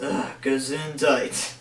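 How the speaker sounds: slow, low, congested mumble; stuffy sick voice. Retold or imitated. Sick Spider-Man blessing his own sneeze, stuffy groan. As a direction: groan it like a sniffly sick hero. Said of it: "he's, like, sneezing".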